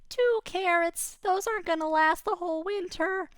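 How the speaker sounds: a rabbit unimpressed by two carrots; glum rabbit. Acted. Rabbit complaining two carrots won't last, high and glum. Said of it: "It says".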